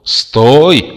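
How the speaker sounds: громкий высокий крик; завопить, останавливая; вопль. A narrator's reading. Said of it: "завопили из ящика". (отчаянный вопль)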